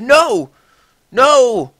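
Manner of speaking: high, loud frantic begging; panicked cries of no, no; desperate pleading. A narrator's reading. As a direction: plead with him not to leave. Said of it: "pleaded Draco".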